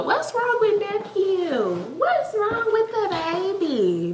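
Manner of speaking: put-on high, slow, cooing baby-talk voice; mocking worried commenters. Mocking commenters who fuss over the crying dog, cooing high and slow. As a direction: coo over the dog like fussing aunties. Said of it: "And here y'all go".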